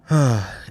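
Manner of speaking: loud, heavy sigh; exasperated sigh. Real, not acted. Host fed up with his own rambling digression, loud sigh. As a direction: let out a big fed-up sigh.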